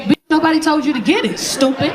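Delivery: high-pitched mock scolding; exasperated mother. Mother answering daughter who wants to quit the job, exasperated.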